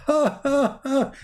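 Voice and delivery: high mocking devil laugh; Shaitan laugh. Mocking Shaitan jumping in your mouth, high devilish laugh. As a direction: cackle like a gleeful devil. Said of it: "he laugh like".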